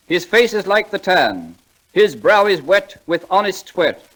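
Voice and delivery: slow, lofty recitation; poem recital. Acted. [name] reciting Longfellow to the audience, slowly and grandly. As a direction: declaim each line like a school prize reciter.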